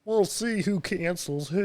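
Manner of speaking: high, slow Kermit-heavy impression; drawn-out mock-tearful Kermit voice; Kermit-like Jordan Peterson. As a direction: lean hard into a high Kermit voice. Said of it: "that was heavy on the Kermit".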